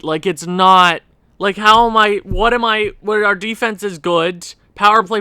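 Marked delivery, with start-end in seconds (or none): none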